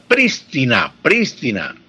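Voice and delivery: "prístina" twice, each stressed on PRÍS; esdrújula stress. Shown as a demonstration. Professor recalls schoolbook word list, "prístina" stressed on first syllable. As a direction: say "prístina" as PRÍS-ti-na, then repeat it. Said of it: "hacía énfasis en la pronunciación esdrújula".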